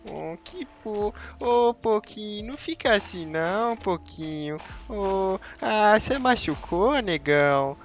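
put-on high, slow baby-talk comfort. Gamer mock-comforts the slain pig in high baby talk. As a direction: coo at it like a hurt toddler.

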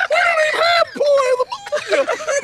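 boss's high-pitched voice. Retold or imitated. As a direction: shriek it high and furious. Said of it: "Like that".

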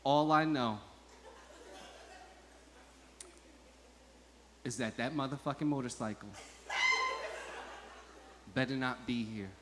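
low, hushed threat with long pauses; quietly seething wife. Retold or imitated. Wife too upset to face her husband, quiet and menacing. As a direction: lay down an ultimatum softly, piece by piece.

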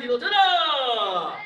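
slow, drawn-out count call with a laugh; sharp martial arts commands. Real, not acted.

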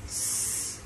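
"s" as a long voiceless hiss; hissed s sound. Shown as a demonstration. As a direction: hold a drawn-out "s" with no vowel. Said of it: "sẽ được phát âm là s". (âm gió "s" kéo dài)

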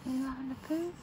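hushed, soft question; soft murmur. Real, not acted. Parent cooing at the baby.

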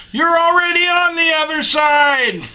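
high, sustained distance holler; yelling back. Acted. Second blonde answering across the lake, high yell back. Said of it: "and then yells back across".

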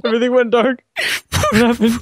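high alarmed cry. Real, not acted. Alarm in the dark.

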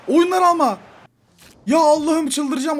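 really losing it at the sub; exasperated outburst. Real, not acted. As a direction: yell it like you can't believe the substitution. Coach subs his player, exasperated.

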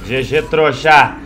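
gloating shout. Real, not acted. Gloating at kill.

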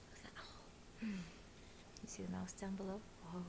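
soft breathy aside; whisper. Shown as a demonstration. Reader asking children about the picture, whispering. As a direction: murmur it to the little listeners. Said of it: "asks children about picture".

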